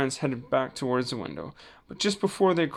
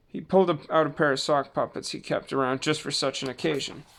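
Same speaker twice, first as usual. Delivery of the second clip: ordinary delivery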